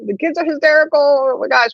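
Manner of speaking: high-pitched mock distress; flustered mom. Mom to dad about the hysterical kids, flustered. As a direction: imitate mom frantically protesting. Mom continues protesting, retold.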